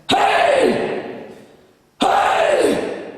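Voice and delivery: distorted shouted hey; harsh distorted hey shout; false chord scream. Shown as a demonstration. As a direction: rip a throaty scream. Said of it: "The false chords".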